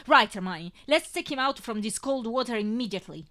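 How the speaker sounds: loud, urgent and firm; urgent command. Acted. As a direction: snap into action, loud. Urgent order to rescue.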